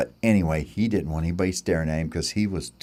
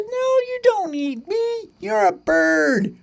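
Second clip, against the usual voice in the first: very high, slow cricket voice; slower than usual. Acted. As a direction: patiently correct the bird, squeaky and drawn out. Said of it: "Cricket said".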